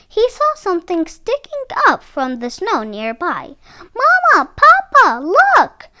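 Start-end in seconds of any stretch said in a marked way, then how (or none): 3.9-5.9 put-on high, loud, excited child's call; amazed child. A narrator's reading. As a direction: call out to the parents in wonder. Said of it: "the child cried with amazement".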